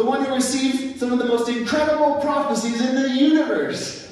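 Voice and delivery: high, loud sermon climax, slowing at the end; impassioned preaching. Acted. Preacher to congregation, impassioned and loud. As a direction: preach it at full force.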